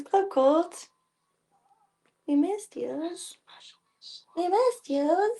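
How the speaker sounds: high, light pretend cat voice; voicing the cats. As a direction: speak for the cats, sweet and high. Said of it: "It says".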